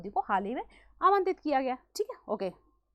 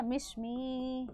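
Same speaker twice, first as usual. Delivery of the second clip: Mishmi long-drawn, softer, like an ad tagline; ad jingle imitation; 5 dB quieter, much slower than usual. Teacher jokingly recalls an ad for students, long-drawn ad imitation. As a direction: imitate a TV ad, stretching Mishmi out very slowly. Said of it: "एक ऐड आती है ना".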